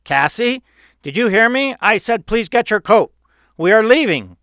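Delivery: high, loud voice of an exasperated father. A narrator's reading. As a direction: demand to be heard, annoyed. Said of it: "speaking in a louder, irritated voice".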